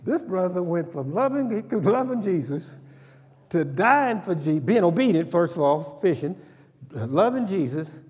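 high, loud fervent preaching; preaching at full force. Acted. Preacher laughing about Peter cussing, full-force preaching. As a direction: shout it to the pews.

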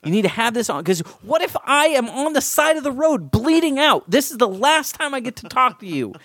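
high, urgent, insistent pleading. Retold or imitated. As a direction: beg her to answer the phone. Pleading with wife about phone.

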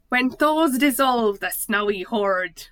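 put-on a failed try at a Scottish accent, slow and loud; attempted Scottish accent. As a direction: read the poem aloud in a bad Scottish accent. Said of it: "that's not Scottish".

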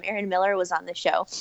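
usual speaking voice

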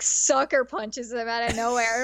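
ordinary talk

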